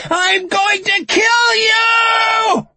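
very high, drawn-out loud scream; murderous scream. A narrator's reading. Lucy brandishes a knife at Frankie, screaming. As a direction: scream the threat at full pitch.

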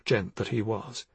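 usual speaking voice

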